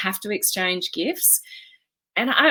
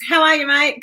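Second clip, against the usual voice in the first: higher-pitched, louder Australian greeting; casual Australian hello, pitched up; 7 semitones higher, 4 dB louder than usual. Real, not acted. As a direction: greet a mate in your natural Aussie accent. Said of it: "that was very Australian".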